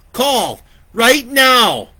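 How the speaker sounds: high, loud commanding yell; urgent shout. Acted. Older girl shouts at Lori to call now. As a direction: yell the order in panic. Chainsaw attack, urgent order.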